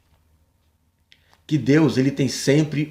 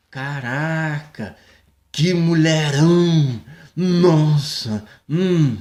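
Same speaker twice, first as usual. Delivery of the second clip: swooning admirer; much slower than usual. Retold or imitated. Mocking app user ogling photo.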